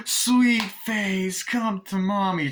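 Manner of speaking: high, loud, slow coo; Tiffany's voice. Retold or imitated. Tiffany hugging Glenn, a high coo. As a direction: coo to her child.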